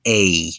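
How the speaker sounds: A drawn out as a gliding "ay-ee"; much longer diphthong. Shown as a demonstration. Teacher showing learners the E-I diphthong, much longer. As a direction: stretch A well beyond a normal vowel. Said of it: "it's much longer".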